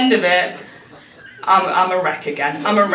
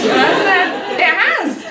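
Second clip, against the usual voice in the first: higher and higher exasperated pitch; pitched-up bickering with mum; rising exasperation; 10 semitones higher than usual. Retold or imitated. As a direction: climb in pitch as patience runs out. Escalating argument with mum.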